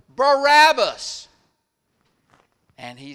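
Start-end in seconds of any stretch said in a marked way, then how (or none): 0.1-1.3 loud, high, drawn-out shout of the name; crowd crying out. Acted. Crowd calling to Pilate for the prisoner's release, loud slow shout. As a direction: bellow it like a whole mob calling for him. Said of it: "the crowd cries out".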